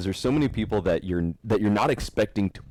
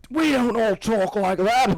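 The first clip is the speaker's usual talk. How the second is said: mock Australian accent; 4 dB louder, slower than usual. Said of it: "doing a stupid Australian accent".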